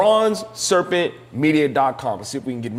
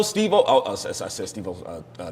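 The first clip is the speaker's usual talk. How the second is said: normal talking voice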